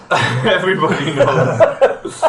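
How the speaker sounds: laughing through the words; giggling tease. Real, not acted. Teasing a friend, laughing.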